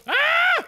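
very high yelp; startled scream. Real, not acted. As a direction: yelp as if caught off guard. Startled by game launch.

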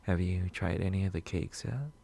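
ordinary talk

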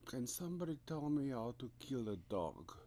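usual speaking voice

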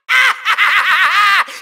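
gloating cackle in quick bursts; evil laugh. Acted. Villain sets clones on the Skylander, evil cackle.

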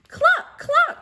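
very high, loud hen cluck; clucking cow. Acted. Teacher voicing Cow for her class, high loud clucking. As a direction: cluck like a chicken. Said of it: "said Cow".

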